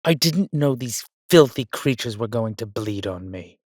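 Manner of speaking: loud, disgusted complaint; indignant outrage. Acted. Vampire to darling about bloodied new shirt, indignant outrage. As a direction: burst out loud, then wind down.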